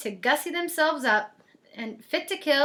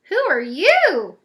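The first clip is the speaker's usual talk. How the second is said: louder, slow, startled who are you; gasped question; 4 semitones higher, 9 dB louder, slower than usual. A narrator's reading. Cindy startled by the old lady, gasping. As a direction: catch your breath and ask it. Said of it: "gasped Cindy Ellen".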